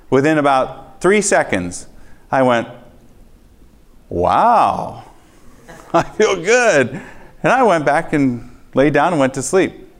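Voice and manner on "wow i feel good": a high, slow, laughing "I feel good"; amazed relief. Retold or imitated. Retelling the moment his stomach healed, delighted. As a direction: savour the sudden relief. Instant relief after the prayer.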